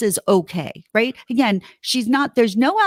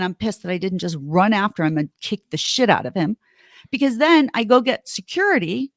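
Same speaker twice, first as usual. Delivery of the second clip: ordinary delivery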